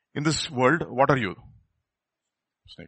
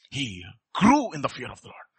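usual speaking voice

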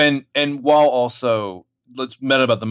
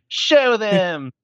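put-on kid's command; 8 semitones higher than usual. John ordering the Terminator.

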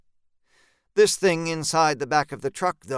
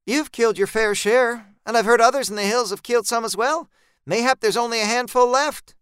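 higher voice for Gene, brisk; a higher, quicker voice for Gene the farmer; Gene voiced higher; 7 semitones higher, 4 dB louder than usual. A narrator's reading. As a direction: Gene explaining, higher pitched. Gene's raised voice.

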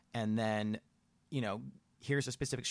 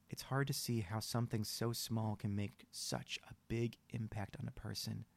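somber story reading; 4 dB quieter than usual. Acted. Reads out sad story.

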